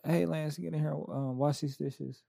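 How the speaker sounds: understated, matter-of-fact mom request; soft, casual retold chore request; not saucy. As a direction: imitate a mom casually asking, softly. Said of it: "it wasn't even like, saucy".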